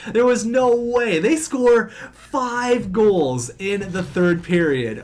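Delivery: can't believe what just happened; stunned disbelief. Real, not acted. Disbelief at collapse.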